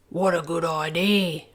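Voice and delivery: lightly voiced young dragon; a grateful dragon, lightly voiced; Zog. Zog answers.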